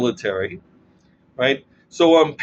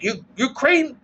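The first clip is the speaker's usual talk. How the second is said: higher, dismissive imitation of her; imitating a Russian acquaintance; 8 semitones higher than usual. Retelling a Russian woman's scorn for Ukraine, higher mock-dismissive voice. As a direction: scoff at the language like she did. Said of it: "She would say things like".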